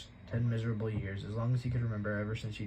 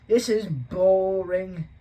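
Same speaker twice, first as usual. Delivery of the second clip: bored moan; 9 semitones higher, 11 dB louder, much slower than usual. Acted. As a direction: drag the words out in sulky boredom. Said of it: "Dudley moaned".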